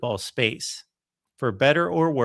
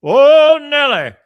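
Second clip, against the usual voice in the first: much higher and much louder, booming announcer call; Keith Jackson impression; 15 dB louder, slower than usual. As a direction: belt the catchphrase like the old play-by-play man. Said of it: "his voice".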